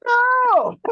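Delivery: long, very high, loud wail; mock anguished cry. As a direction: cry out in comic horror. Retold dismay at gluten-free news.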